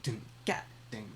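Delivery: beatbox the kick then the snare; vocal kick and snare. Shown as a demonstration. Teacher voicing a kick and snare for students, mouthed drums. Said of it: "that has that higher sound".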